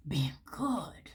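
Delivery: a real choked, teary whisper; tearful whisper. Real, not acted. Reader, moved to tears for Virgo, whispers tearfully. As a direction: let the words crack into a whisper. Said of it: "I'm so emotional right now for you".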